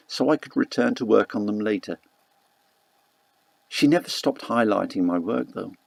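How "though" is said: ordinary delivery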